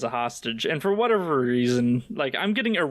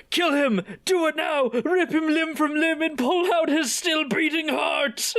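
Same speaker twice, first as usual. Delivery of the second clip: mock screaming; 12 semitones higher than usual. Chief turning on his captor, hammed-up screaming order. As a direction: bellow the order like a crazed villain. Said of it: "immediately screaming".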